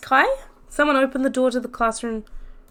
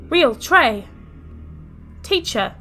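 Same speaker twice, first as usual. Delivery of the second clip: calling out; 3 semitones higher, 3 dB louder, much slower than usual. Acted. Calling out names.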